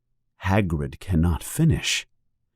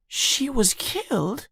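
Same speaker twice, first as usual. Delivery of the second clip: shocked Harry; 6 dB quieter than usual. A narrator's reading. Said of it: "yells Harry".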